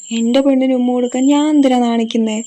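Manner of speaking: flirty, amused reply to his shy sweetheart; laughing, teasing. Acted. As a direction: tease her back with a laugh. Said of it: "ദേവൻ ചിരിച്ചുകൊണ്ട് പറഞ്ഞു".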